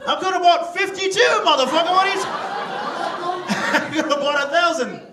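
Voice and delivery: loud, high outburst at the heckler; a comic yelling at an audience member who heckled him; outraged shout. Real, not acted. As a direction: bellow it back at the crowd.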